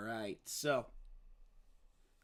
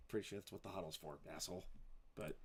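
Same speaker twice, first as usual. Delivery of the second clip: low, quick, much softer mutter; muttered aside; 9 semitones lower, 14 dB quieter, faster than usual. Real, not acted. Announcer grumbles about the huddle remark, quick low mutter. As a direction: drop out of announcer voice and grumble under your breath.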